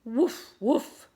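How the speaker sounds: high, loud startled woofs; startled dog. A narrator's reading. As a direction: bark out two sudden woofs. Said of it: "said the startled dog".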